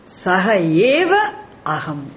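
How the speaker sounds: eva lifted and stretched; emphatic eva. Shown as a demonstration. Teacher explaining emphatic eva in the mantra. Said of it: "he adds to make it emphasized".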